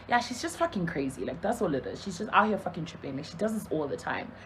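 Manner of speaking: low, soft shrug-it-off retelling; quiet, low-pitched nonchalance; calm, unbothered. Retold or imitated. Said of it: "he was so calm about it".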